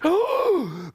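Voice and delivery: high theatrical intake of breath; mock frightened gasp. Baby goat hearing the troll, mock frightened gasp. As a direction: gasp in pretend terror.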